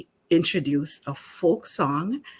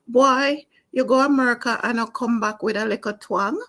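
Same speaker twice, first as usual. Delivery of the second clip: Jamaican patois lilt, quick and high; Miss Lou's Jamaican voice; 7 semitones higher, faster than usual. Retold or imitated. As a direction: Jamaican folk teller's lively patois voice. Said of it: "As Miss Lou would say".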